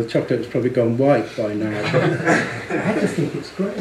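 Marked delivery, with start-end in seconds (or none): 1.9-3.8 laughing. Real, not acted. Laughing fondly.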